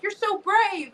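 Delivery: high-pitched mock admiration; imitating a fan's high coo; gushing fan. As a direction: gush like an adoring onlooker. Mimics admiring fans.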